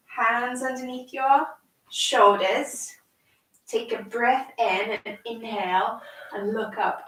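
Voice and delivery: slow, gentle yoga cueing; calm instructions for a small child; reading to a toddler. Acted. Guides a child through yoga poses.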